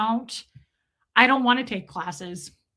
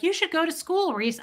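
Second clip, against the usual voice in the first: higher-pitched mimicked advice; imagined advice-giver; 6 semitones higher than usual. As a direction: lightly imitate viewers giving unwanted advice. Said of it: "those of you that are about to say something like".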